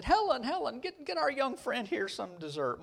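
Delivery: high, fussing call to his wife; eager host. Retold or imitated. Husband to wife about the young guest, eagerly hospitable. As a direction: call out to get the guest fed. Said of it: "and he said".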